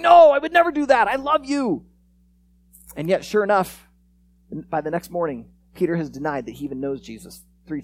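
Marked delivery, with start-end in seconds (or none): 0.0-1.9 high, loud, insistent vow; acted-out impassioned denial; fervent protest. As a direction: protest as if wounded by the very idea. Peter protests Jesus's prediction of denial.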